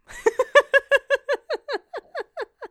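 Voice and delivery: long, high staccato giggle; high laugh. Real, not acted. Losing it at own mezzo joke, high laugh. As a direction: burst into a high-pitched laughing fit.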